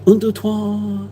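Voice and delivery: slow hammed-up French counting; a hint of a put-on French voice; mock French. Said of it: "that's all my French".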